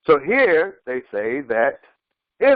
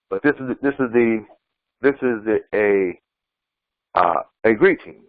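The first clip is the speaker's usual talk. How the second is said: usual speaking voice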